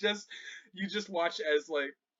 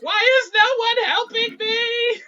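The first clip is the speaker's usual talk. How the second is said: Princess wailing; 10 dB louder than usual. Acted. Princess ignored in the background, wailing for help. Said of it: "you hear Princess in the back".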